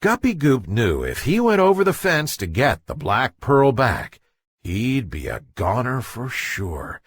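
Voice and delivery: usual speaking voice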